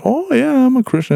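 high put-on coworker voice; coworker's reply. Coworker quoted in work story.

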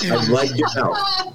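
high, slow, laughing through the line. Retold or imitated. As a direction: giggle out the creepy line. Laughing imitation of creepy line.

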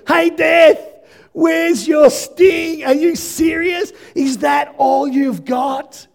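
high, loud taunting of death, drawn out; mocking taunt. Preacher voicing Jesus taunting death in a loud mocking shout. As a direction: jeer at death like a fighter who can't be beaten. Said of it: "This is mocking".

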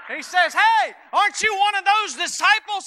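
loud, high, driving sermon delivery; preaching at full force. Acted. Pastor to congregation, preaching hard and loud.

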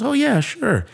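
bright teller. Retold or imitated. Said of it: "she goes".

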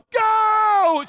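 long, high-pitched yell; yelled command. Retold or imitated. Wife yells at husband to flee security, urgent yell. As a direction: imitate a wife screaming to run. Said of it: "She just yelled".

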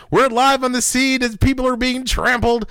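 high, loud breathless on-scene reporter; news reporter voice. Pretend. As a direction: shout it like live chaos coverage. Mock news report from riot.

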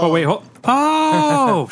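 high, long-drawn gasp of recognition; surprised realization. Real, not acted. Joining the group's recognition a beat late, drawn-out surprise. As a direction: stretch the last "oh" way up high.

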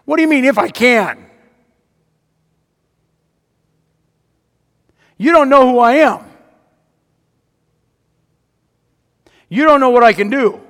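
high, loud incredulous rebuke; affronted shouting; indignant rebuke. Acted. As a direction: thunder back at the doubting father. Jesus rebukes the father.

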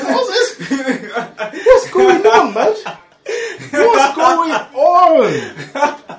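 high, loud, laughing what's going on; laughing disbelief. Retold or imitated. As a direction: cry out in amused shock. Retelling being confronted.

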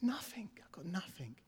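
hushed, nearly voiceless letdown; deflated whisper. Retold or imitated. Man who felt left out reports getting nothing, deflated whisper. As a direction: whisper it as if let down. Retells God giving no answer.

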